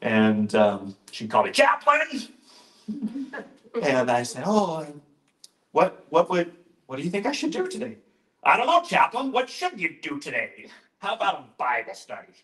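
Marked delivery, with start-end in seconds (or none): 1.5-2.3 high, loud mock summons; grumpy nurse manager. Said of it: "she was a grumpy woman". 4.5-8.0 lightly acted high, hurried question; eager young chaplain. Young chaplain answering grumpy nurse. 8.4-12.3 grumpy nurse manager. Retold or imitated. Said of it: "she was a grumpy woman".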